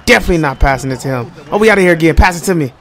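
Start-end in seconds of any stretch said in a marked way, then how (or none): none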